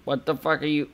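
ordinary talk

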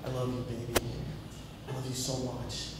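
smooth ladies' man murmur; Mister Cool. Pretend. Showering with a girl, playing it cool, soft low croon. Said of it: "Trying to be Mister Cool".

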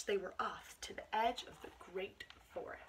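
ordinary talk, nothing marked

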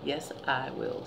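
normal talking voice

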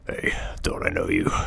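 put-on low, whispered line in a thick New Jersey or Mediterranean accent; a thick, barely penetrable New Jersey or Mediterranean accent. A narrator's reading. Cab driver sizes up his passenger, thick New Jersey or Mediterranean accent. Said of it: "in a thick, barely penetrable accent".

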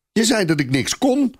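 put-on a little boy stamping his feet in rage; stamping shriek. A narrator's reading. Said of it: "gilt het jongetje stampvoetend".

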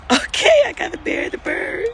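very high tearful wail; real sobbing distress; crying. Real, not acted. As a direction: burst out high, then keep crying through it. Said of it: "is that why I'm crying".

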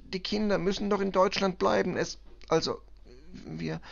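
high, increasingly agitated self-justification; distraught cleaning woman. Acted. Cleaning woman explains being late for work, agitated and faltering. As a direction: insist on duty, then falter and break off.

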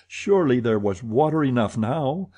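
plain reading voice

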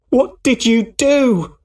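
a son screaming at the man by his father's body; shouting. A narrator's reading. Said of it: "he shouted".

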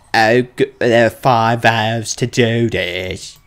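usual speaking voice

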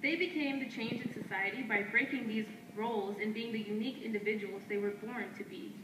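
firm, declaiming poetry lines traded back and forth; spoken word duet. Acted. Two poets trading lines on stage, in a firm declaiming delivery. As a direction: answer your partner line by line like a chant.

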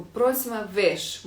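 «просьма виш» дуже повільно і розтягнуто; розтягни кожен склад; повільно, по складах. Shown as a demonstration. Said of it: "типу". (повільно проказує португальську фразу для учнів)

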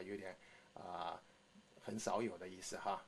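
normal talking voice

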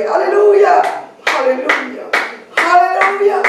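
shouting praise, pitched high; fervent climax. Real, not acted. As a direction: cry it out at the peak of the prayer.

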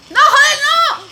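loud, high cry of defeat; anguished shout. Real, not acted. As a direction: scream it as you die. Gets killed in the game.

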